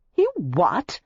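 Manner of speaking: stunned disbelief; astonished. A narrator's reading. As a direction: caught completely off guard. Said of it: "Her mouth fell open in astonishment".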